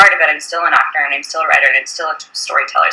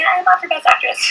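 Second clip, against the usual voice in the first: high, indignant mock diva; hammed-up outrage at the check-in desk; mock diva indignation; 12 semitones higher than usual. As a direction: play the offended star, tongue in cheek. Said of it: "I was like".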